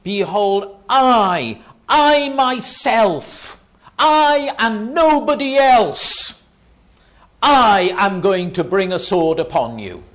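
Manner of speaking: very high, loud hammering of "I", "I myself"; every "I" punched high and stretched. Retold or imitated. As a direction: proclaim "I and nobody else" at full force. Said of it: "even more emphatic in the original language".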